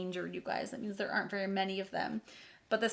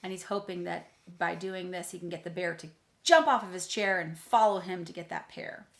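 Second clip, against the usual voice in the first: usual speaking voice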